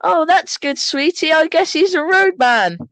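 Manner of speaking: high, put-on parent voice; mock doting parent, pitched high; pretend sweet spouse. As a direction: answer sweetly as the other parent. Acts other parent replying to spouse.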